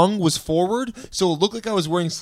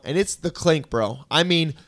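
ordinary talk, nothing marked

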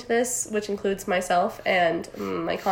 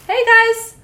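mock YouTuber greeting; 11 semitones higher, 6 dB louder than usual. Mock sponsor intro.

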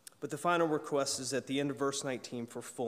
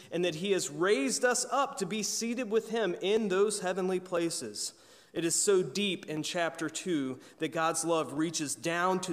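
higher and louder, rising preacher's fervor; preaching at full force; 4 semitones higher, 5 dB louder than usual. Acted. Pastor stirring his congregation on God's love, fervent preaching. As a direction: lift the congregation with each clause.

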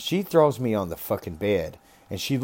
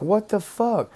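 shocked; 12 semitones higher, slower than usual. Retold or imitated. Retold shock at being punched.

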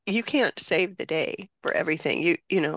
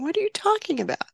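high and fast, not taking it in; stunned disbelief; 12 semitones higher, faster than usual. Retold or imitated. Grandmother to grandson at terrible news, high frantic disbelief. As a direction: blurt it out in shock. Grandson says his dad died.

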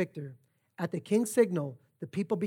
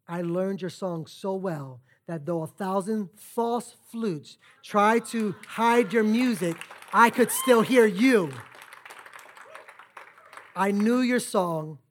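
slow and swelling louder; slow down and build to a loud peak; building preaching climax; 4 dB louder, slower than usual. Acted. Climax of sermon story, building.

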